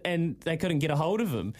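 ordinary delivery

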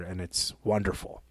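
usual speaking voice

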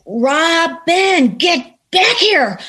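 a mother calling her daughter back, drawn out. Retold or imitated. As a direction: call the child back from across the room, slowly. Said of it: "And she went".

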